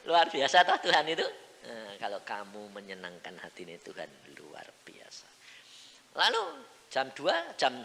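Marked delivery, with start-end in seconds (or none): 1.7-5.3 low, near-whispered assurance; hushed aside. Real, not acted. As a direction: drop to a soft murmur. Menurunkan suara jadi bisikan. (ucapan lirih hampir berbisik)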